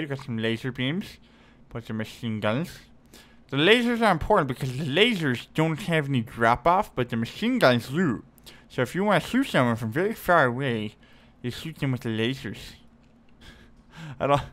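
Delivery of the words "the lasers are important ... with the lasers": put-on nerdy know-it-all explainer; cartoon nerd. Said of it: "stereotypical cartoon nerd voice".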